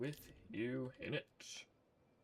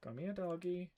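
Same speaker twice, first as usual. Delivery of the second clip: put-on higher childlike "Come here, doggy"; imitating son; 6 semitones higher than usual. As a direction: say it like a little kid. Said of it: "as my son would say".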